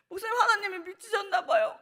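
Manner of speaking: high voice, laughing through tears; laugh-crying. Retold or imitated. Girl telling the pastor through tears, laugh-crying. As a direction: blurt it out high, half laughing.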